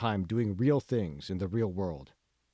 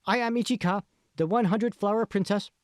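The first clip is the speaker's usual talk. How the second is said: put-on very high, bright-eyed Japanese accent as a cosplay princess; strong Japanese accent; 10 semitones higher, 3 dB louder than usual. A narrator's reading. Cosplayer answers the reporter, in a high strong Japanese accent. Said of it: "Speaking with a strong Japanese accent".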